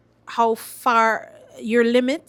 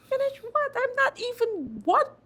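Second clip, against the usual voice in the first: much higher, baffled disbelief; incredulous. Retold or imitated. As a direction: squeak out the indignant disbelief. Her reply in studio.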